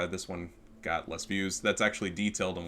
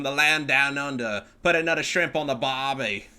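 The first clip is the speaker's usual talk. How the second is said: lightly put-on Aussie accent, a little louder; mock Australian accent; 3 semitones higher, 5 dB louder than usual. Streamer teasing a salty Australian boy, mock Australian accent. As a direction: tease with a mock Australian twang.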